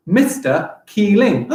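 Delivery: slow, beat-by-beat "Mister Keeling"; measured demo of "Mister Keeling"; syllable by syllable. Shown as a demonstration. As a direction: split "Mister Keeling" into four clear beats. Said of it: "He's also got four syllables".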